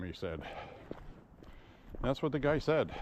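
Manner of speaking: ordinary talk, nothing marked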